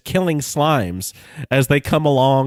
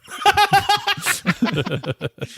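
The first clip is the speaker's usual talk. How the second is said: high breathless laughter; wild cackle. Real, not acted. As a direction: crack up after the cry. Laugh after the cry.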